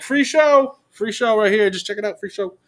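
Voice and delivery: high, sing-out street hawker; barker's call. Retold or imitated. Filmmaker invites wedding guests, high hawking call. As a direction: call passers-by over to watch.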